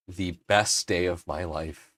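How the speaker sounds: normal talking voice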